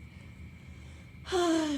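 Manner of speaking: long breathy sigh. A narrator's reading. Daisy sighs at Gatsby.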